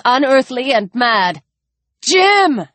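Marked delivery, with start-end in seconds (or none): 2.0-2.7 high call to someone; raised, calling voice; shout. A narrator's reading. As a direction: shout the name urgently. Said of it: "shouted the man with the rifle".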